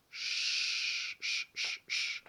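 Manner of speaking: "ch" long, then short repeated hushes; hushing SH sounds. Shown as a demonstration. Teacher comparing the sound to shushing, repeated SH. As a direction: hiss "ch" like shushing someone. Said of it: "le bruit qu'on fait quand on fait chut".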